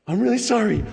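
imitating the shaken girlfriend; upset apology. Girlfriend apologizes after swerve.

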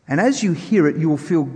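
ordinary talk, nothing marked

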